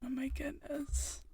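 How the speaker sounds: high, drawn-out whisper; hushed disbelief. Real, not acted. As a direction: whisper it slowly, half in disbelief. Aghast aside about a weird show.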